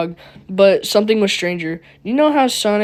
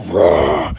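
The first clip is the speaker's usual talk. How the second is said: very low, loud mock roar; animalistic roar; 3 dB louder than usual. Sonic roars at Amy before biting her, a low mock roar. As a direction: growl out a beast's roar. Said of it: "the most animalistic roar".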